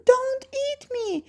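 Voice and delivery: very high, slow plea; frightened grandmother shout. Acted. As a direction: cry out high in play-acted terror. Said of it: "shouts grandmother".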